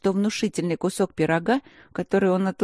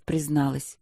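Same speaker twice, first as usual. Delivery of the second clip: usual speaking voice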